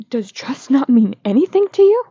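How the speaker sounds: furious yell, voice up high; angry. Acted. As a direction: fling the question at him in fury. Nick yells at Charlie about betrayal.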